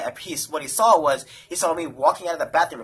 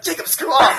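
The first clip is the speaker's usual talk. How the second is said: louder, higher, annoyed shout; mock yell; 10 semitones higher, 6 dB louder than usual. Telling the friend to stop slapping the bed, annoyed yell. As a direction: yell at him to quit.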